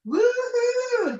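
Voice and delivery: high joyful woohoo; excited whoop. Real, not acted. Thrilled guest whooping to the webinar crowd. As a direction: cheer it out with delight. Excited testimonial opening cheer.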